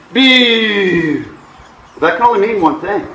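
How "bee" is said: long high tone sliding downward; imitated sink alarm. Shown as a demonstration. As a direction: drone like a variometer in heavy sink. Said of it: "you get big sync".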